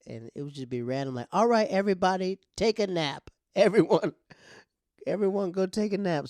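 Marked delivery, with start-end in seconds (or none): 1.3-6.3 loud, higher bossy mom voice; mom's nap order. Retold or imitated. Mom calling the kids to bed, loud and bossy.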